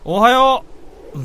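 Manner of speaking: big, high, loud good morning; loud greeting. Acted. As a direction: call it out cheerfully across the room. Greeting coworker across office.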